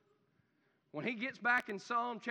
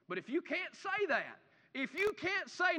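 ordinary talk, nothing marked

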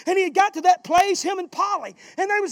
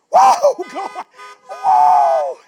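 ecstatic whoa; 4 semitones higher, 9 dB louder, much slower than usual. Real, not acted. Pastor erupting over healing, ecstatic shouted whoa. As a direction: burst out in joy.

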